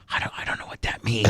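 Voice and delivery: retold confused whisper to the boss. Whispering his confusion to the boss. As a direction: breathe it out, baffled.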